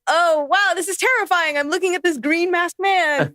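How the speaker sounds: high mock-terrified gush; sarcastic. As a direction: fake fright, sarcastically high. Sarcastic actor reaction.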